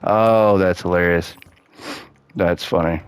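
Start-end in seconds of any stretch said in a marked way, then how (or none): none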